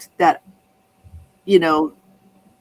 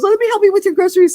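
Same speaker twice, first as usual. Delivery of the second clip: high, loud, fast, fussing helper; flustered offer; 14 semitones higher, 6 dB louder, much faster than usual. Retold or imitated. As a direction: rush the offer out in a panic. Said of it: "the guy was flipped out".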